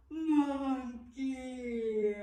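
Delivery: long yawned word; monkey yawn. Shown as a demonstration. Teacher yawning monkey with the class. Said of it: "Can we yawn".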